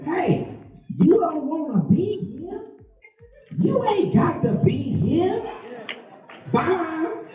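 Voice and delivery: high mocking wife voice behind the door. Comic husband mimics wife shutting him out, high voice. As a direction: play the wife, high and dismissive.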